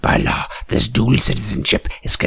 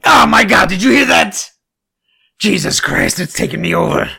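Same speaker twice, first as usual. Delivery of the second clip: high, loud, hammed-up alarm; a cartoonish panicked cry of being taken over; mock possession; 12 semitones higher, 5 dB louder than usual. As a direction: pretend to be seized by a spell. Pretends the book possesses him.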